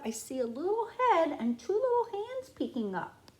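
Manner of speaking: plain reading voice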